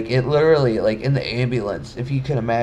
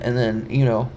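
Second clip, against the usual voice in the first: ordinary delivery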